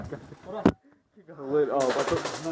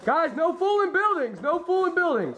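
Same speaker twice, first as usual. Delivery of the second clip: urgent calling out; 7 semitones higher than usual. Real, not acted. Warning teammates during firefight.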